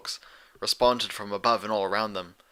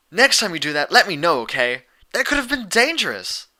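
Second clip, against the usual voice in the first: louder, higher scolding; chiding; 7 semitones higher, 6 dB louder than usual. Acted. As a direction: scold her friend for the scare. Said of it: "Dash chided".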